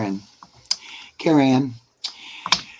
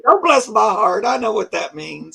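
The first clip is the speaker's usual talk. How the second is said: indignant retort; 8 semitones higher, 6 dB louder than usual. Retold or imitated. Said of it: "a few people like going".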